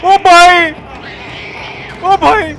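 high, loud call across a distance; loud high-pitched call. Real, not acted. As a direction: shout for attention. Calling out to someone.

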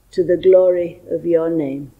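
ordinary talk, nothing marked

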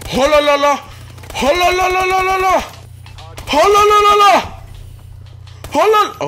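loud high-pitched la-la holler; celebratory whooping. Real, not acted. Gamer celebrating a kill, celebratory whooping. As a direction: whoop it out in rapid la-las.

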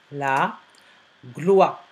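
"La", pause, then "gloire" on its own; syllable by syllable. Shown as a demonstration. Teacher showing how to work, "La gloire" syllable by syllable. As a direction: separate "La gloire" into its syllables for spelling. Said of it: "Travaillez par syllabe".

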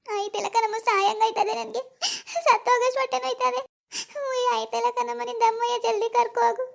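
1 wailing in pain. Acted. Daughter wailing in labour pain.

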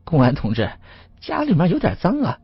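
high, anxious apology; eager, uneasy politeness to an official; flustered. A narrator's reading. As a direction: apologize nervously in a lifted pitch. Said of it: "吴老三慌了神啊".

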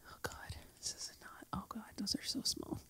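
breathless shocked whisper. Real, not acted. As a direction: hush it in disbelief. Shocked at small props.

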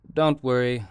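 lightly amused, easing the man's worry; humorous. Acted. Stedman reassuring the nervous bank man, lightly amused. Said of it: "said Stedman humorously".

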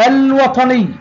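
الوطني with a clearly sounded l; moon lam. Shown as a demonstration. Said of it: "لام قمرية". (نطق «الوطني» بلام ساكنة واضحة)

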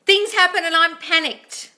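high, loud, slow hammed-up panic; mock panic. Coach mimics an anxious viewer freaking out, mock panicked. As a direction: wail it in exaggerated alarm. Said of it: "really anxious and panicked".

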